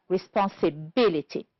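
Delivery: "responsibility" stressed on BIL; stress on fourth syllable. Shown as a demonstration. Teacher naming the only such word, fourth syllable of "responsibility" stressed. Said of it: "stressed on the fourth syllable".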